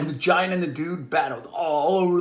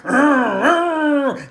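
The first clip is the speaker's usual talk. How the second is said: motorcycle revving; 6 semitones higher, 4 dB louder than usual. Pretend. Motorcycle engine sound.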